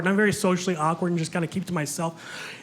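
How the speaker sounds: normal talking voice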